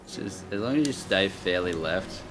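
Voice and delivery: ordinary delivery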